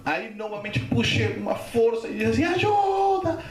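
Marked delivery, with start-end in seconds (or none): 2.4-3.4 high, stretched-out yell for help; cry for help. Retold or imitated. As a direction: shout as if going under, acted. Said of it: "gritei assim". (grito agudo de socorro, encenado)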